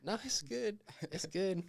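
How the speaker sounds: high, nervous brush-off; high-pitched awkward reassurance. Retold or imitated. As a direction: squeak out a nervous no. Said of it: "And I was like".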